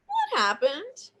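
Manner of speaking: slow, high-pitched mom's query; questioning mom. Retold or imitated. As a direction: lightly imitate a suspicious mother, drawn out. Voices mother holding up costume.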